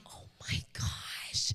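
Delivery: a slow, voiceless whisper of disbelief; slow stunned whisper. Retold or imitated. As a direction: drag it out in a shocked whisper. Mother hears what daughter did.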